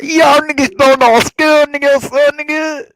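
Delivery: put-on showing how he'd greet people in the Jamaican accent. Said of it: "You sound like you're from Minnesota".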